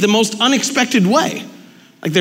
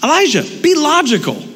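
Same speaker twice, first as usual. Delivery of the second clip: exasperated plea; 7 semitones higher than usual. Retold or imitated. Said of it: "It's just".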